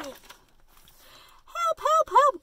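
small, high-pitched plea; little truck crying help. A narrator's reading. Stuck truck calls to his friends, high crying voice. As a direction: squeak out a storybook cry for help. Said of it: "cried the Little Blue Truck".

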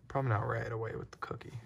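quick whispered aside. Real, not acted. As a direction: whisper it so she won't hear.

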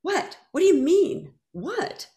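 high, incredulous whats; rising, bewildered questions; stunned disbelief. Retold or imitated. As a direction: caught completely off guard. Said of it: "And I went".

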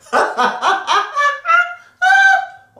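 very high, loud cackling laugh; nasal head-voice laugh. Shown as a demonstration. Coach mixing registers, high loud laugh. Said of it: "elle est mélangée avec moi, ma voix de tête".

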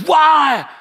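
high, loud, drawn-out yell; imitated anguished shout; retold screaming. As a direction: scream the question in disbelief. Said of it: "yelling at me and screaming".

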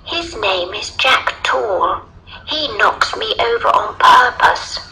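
put-on high little girl voice. A narrator's reading. Little girl complaining to the lion, high child voice.

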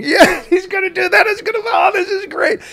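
high, loud, laughing cheer of a thrilled crowd; thrilled audience. Retold or imitated. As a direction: shout it like a delighted audience. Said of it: "and they're going".

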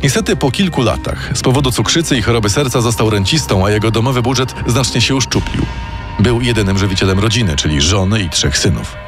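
ordinary delivery

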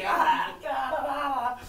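loud, high mock wail; hammed-up drunken "aah"; imitated drunken wailing. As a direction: wail wildly as your drunk self. Acts out his drunken self.

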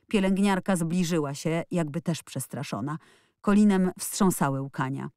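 usual speaking voice

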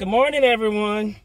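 loud, high cheerful greeting; talking too loud. Real, not acted. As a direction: call out the greeting loudly over music. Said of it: "excuse me if I'm talking too loud".

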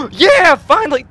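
high gasp, then a happy shout; excited relief. Real, not acted. Gamer hearing Brucey calling at last, excited shout. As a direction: burst out, finally relieved.

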